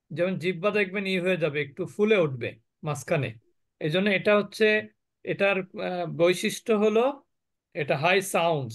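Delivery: ordinary talk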